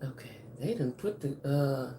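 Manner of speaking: ordinary talk, nothing marked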